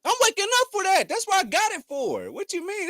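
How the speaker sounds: indignant. Real, not acted. Defends watching camera early.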